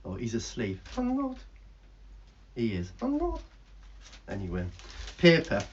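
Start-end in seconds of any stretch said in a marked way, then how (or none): 1.0-1.4 cheeky bird puppet voice; squeaky puppet bird. Acted. Bird denies being asleep. 3.0-3.4 cheeky bird puppet voice; squeaky puppet bird. Acted. Bird denies being asleep.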